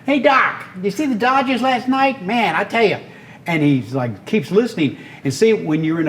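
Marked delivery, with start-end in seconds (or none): 0.0-3.0 high, chatty Brooklyn cabbie; be a talkative cabbie from Brooklyn; Brooklyn cab driver. Retold or imitated. Cab driver chatting about the Dodgers, Brooklyn accent. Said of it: "with this Brooklyn accent".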